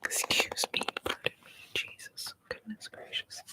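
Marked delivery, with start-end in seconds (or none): none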